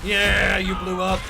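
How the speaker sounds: loud gleeful shout; cheering shout. Real, not acted. Streamer cheering as an enemy blows up, loud shout. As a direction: whoop at the explosion.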